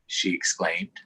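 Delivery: put-on lively read-aloud for young kids; story read to children. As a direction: read the picture book with animated voices. Reading picture book aloud to children.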